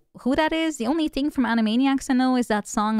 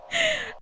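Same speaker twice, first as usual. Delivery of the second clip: short laugh; 3 dB quieter than usual. Real, not acted. Laughs at own voices.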